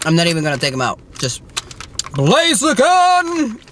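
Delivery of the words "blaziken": excited shout. Real, not acted. Thrilled at finding the figure.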